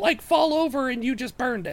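very high-pitched halfling voice; questioning halfling Jorik. Acted. Halfling Jorik asks how they caught the creature, questioning. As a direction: probe for answers in a small, high voice.

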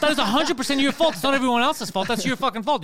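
loud, high-pitched retold argument; heated retelling of a quarrel; angry blaming. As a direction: hammer home the blame, reenacting outrage. Retold argument over blame.

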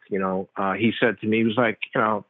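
normal talking voice

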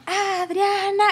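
high mock crowd call; lifted, cheering call; friends' retold yell. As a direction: imitate friends shouting a name, held back in volume. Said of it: "mis amigos estaban y gritaban".

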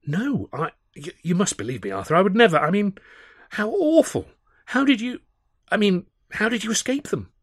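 high-pitched, stammering protest; flustered horror, voice leaping up; appalled. A narrator's reading. Charlie protests his innocence to Arthur, appalled. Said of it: "Charlie looked genuinely appalled".

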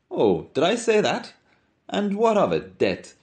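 high, airy mock surprise; mock innocence. Mulligan brushes off Stephen's hurt, high mock innocence.

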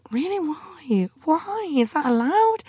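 alarmed, climbing disbelief at a creepy remark; shocked disbelief. Retold or imitated. Patient answering her gynecologist, high incredulous squeak. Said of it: "I'm like".

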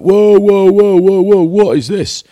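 high, loud, drawn-out teasing whoa; exaggerated high yelping disbelief; mock astonished shout. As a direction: shout it out like a ribbing teammate spotting something flashy. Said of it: "And he went".

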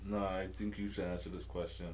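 ordinary talk, nothing marked

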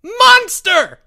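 very high, loud accusing scream. A narrator's reading. Jeffrey accuses Albert, screaming. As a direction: scream it out at the top of the voice. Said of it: "screamed Jeffrey".

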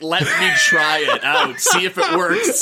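hammed-up through laughter; imitating Alan Rickman. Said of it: "he's just like".

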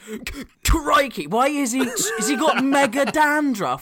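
parroting a bad Hugh Grant, laughing; mocking the impression. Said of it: "When has Hugh Grant had Tourette's and said".

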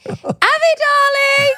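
high, loud, drawn-out mock dad call; embarrassing dad calling. Dad at the school gate with Netto bag, embarrassing high call. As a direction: imitate a dad hollering in singsong across the playground. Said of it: "he'd say".

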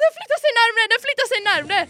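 high, loud panicked warning; mock terrified alert; retold frightened cry. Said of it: "Hon bara, hon bara".

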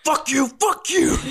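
very high-pitched taunting yell; gleeful attack yell. Acted. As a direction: screech it at the monster as you strike. Player taunts monster while striking it.

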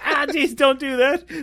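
high, pained pleading; high-pitched mock complaint; mock pained protest. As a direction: protest as if hurting, played for laughs. Acting man whose knife is pulled.

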